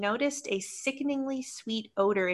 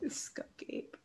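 breathy spooky whisper; 18 dB quieter than usual. Acted. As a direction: hush it like a secret. Whispered callback for effect.

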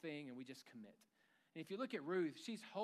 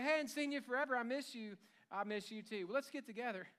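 gushing fake greeting; 7 semitones higher, 5 dB louder than usual. Mocking fake church-lobby greeting.

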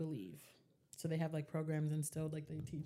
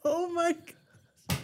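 stunned; 11 semitones higher, 7 dB louder, much slower than usual. Retold or imitated. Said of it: "I was like".

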